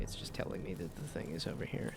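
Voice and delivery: ordinary talk, nothing marked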